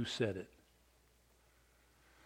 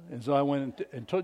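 ordinary talk, nothing marked

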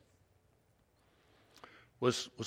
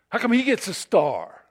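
put-on whiny, louder spoiled-child complaint; 8 semitones higher, 10 dB louder than usual. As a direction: sulk like a spoiled kid. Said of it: "like a spoiled child".

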